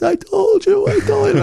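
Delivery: high, giddy I told you so; Boston fan gloating after the Cubs win; gleeful told-you-so. Retold or imitated. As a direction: crow it, voice way up with joy.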